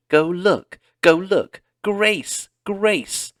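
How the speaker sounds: high, loud frantic scream. A narrator's reading. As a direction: scream at her sister to go. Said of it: "Carrie screamed".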